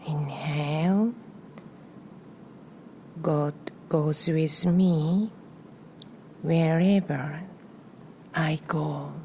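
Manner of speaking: calm meditation voice with long pauses between phrases; guided breathing meditation. Real, not acted. Guiding listeners to inhale and exhale on a prayer, meditative. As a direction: lead the breath gently, phrase by phrase, leaving room after each.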